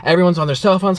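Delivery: ordinary talk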